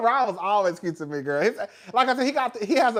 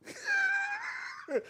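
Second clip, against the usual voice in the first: very high-pitched, softer laugh; squealing laugh; 6 dB quieter than usual. Real, not acted. Streamer laughing at own guess about Chelsea prices, squealing laugh. Laughs after pricing remark.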